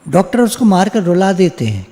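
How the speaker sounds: normal talking voice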